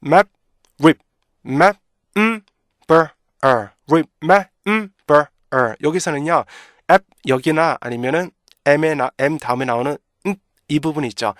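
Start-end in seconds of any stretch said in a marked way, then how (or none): 0.0-5.7 "rip, map, 음, 버, 어" as detached syllables; loud staccato drill of remember's pieces; beat-by-beat breakdown. Shown as a demonstration. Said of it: "한번 해볼까요?".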